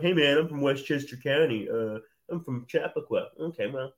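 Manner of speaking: mock Long Island guy, loose and cocky; imitating a cocky New York kid; Long Island bro voice. Teasing Cornell students by imitating a Long Island kid. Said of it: "I don't know why they're talking like that".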